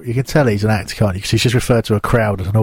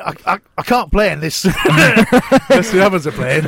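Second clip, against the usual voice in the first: put-on stammering Yorkshire-accented complaint; 6 semitones higher than usual. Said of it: "got the Domino Yorkshire accent".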